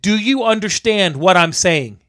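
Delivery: slow, spelled-out re-enacted question; slow, deliberate. Electrician checks man understands, slow deliberate demand. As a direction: space the words out for poor English. Retold, spelling it out for non-English speaker.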